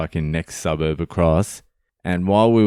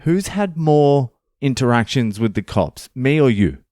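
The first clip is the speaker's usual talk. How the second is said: retort; 6 semitones higher than usual. Retold or imitated. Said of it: "I'm like".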